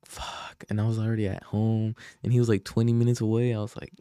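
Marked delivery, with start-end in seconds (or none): 0.1-0.6 unvoiced curse under the breath; muted curse. Retelling his reaction to a late text, muted curse. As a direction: swear softly to yourself, barely audible. Reluctant reaction retold, near silent.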